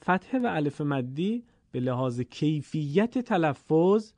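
ordinary talk

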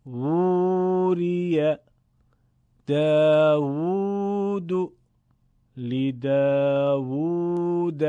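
slow chanted word list, long vowels sustained; elongated waw. Shown as a demonstration. Teacher reads small-waw examples, slow drawn-out recitation. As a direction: hold each long "oo" steadily. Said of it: "تلفظش با واو مدی بزرگ".

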